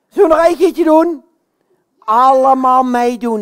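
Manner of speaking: loud rallying call; rallying the audience. Pretend. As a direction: call on all the children to join in. Pop roept publiek op. (luid aanmoedigend roepen)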